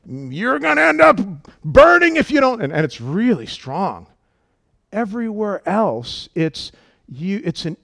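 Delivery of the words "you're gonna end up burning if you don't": mock fire-and-brimstone warning, much higher; a hint of a stern hellfire preacher; pitched-up mock threat of burning; scolding preacher. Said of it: "It's like".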